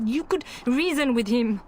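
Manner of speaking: ordinary talk, nothing marked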